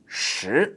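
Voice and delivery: "shi" with rising pitch; second tone. Shown as a demonstration. Teacher showing learners number ten, rising second tone. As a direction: slide "shi" upward. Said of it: "That's a second tone, just going up".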